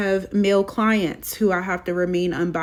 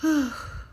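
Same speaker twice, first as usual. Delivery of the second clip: a long, trembling sigh; shaky sigh; 8 semitones higher, 4 dB quieter than usual. Real, not acted. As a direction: sigh while holding back tears. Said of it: "I'm trying not to cry".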